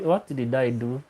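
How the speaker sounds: ordinary talk, nothing marked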